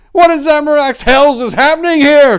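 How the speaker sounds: loud, high bewildered shout. Acted. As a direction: bellow in shock. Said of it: "Tor cried out".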